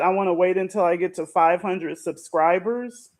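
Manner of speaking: ordinary delivery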